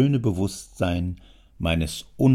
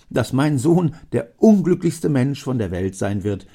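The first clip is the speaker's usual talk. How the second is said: insistent father; 3 semitones higher, 6 dB louder than usual. Acted. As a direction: assure him eagerly.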